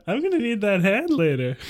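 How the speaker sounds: high, loud teasing reply; very high, loud character voice; grinning Johann. Acted. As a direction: tease through a grin. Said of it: "grinned Johann".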